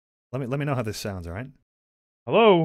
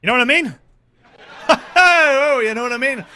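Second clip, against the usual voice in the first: laughing; 10 semitones higher, 9 dB louder than usual. Real, not acted. Laughing fit.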